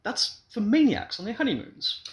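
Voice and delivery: scoffing. A narrator's reading. Husband scoffing.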